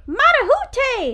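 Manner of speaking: high, slow, ringing call of the name; drawn-out high shout of Marahute; eagle call. Pretend. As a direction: sing out the name like a soaring cry.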